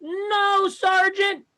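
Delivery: high, stiff recruit voice, slow at first; proud recruit. Retold or imitated. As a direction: report to the sergeant like a proud private. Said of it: "when I was in the Army".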